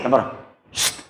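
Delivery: put-on soft hush to the other kids. Anton hushing his friends during prayer, soft shush. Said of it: "anaknya kan gini".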